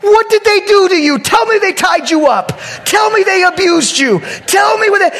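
high loud pleading; frantic questioning. Retold or imitated. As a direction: demand answers in mock alarm. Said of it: "I was like".

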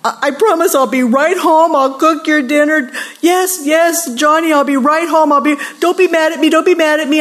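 high, frantic appeasing mom on the phone; anxious, pleading mock mother; placating mother. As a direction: beg the child not to be mad. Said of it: "this is how a mother is talking".

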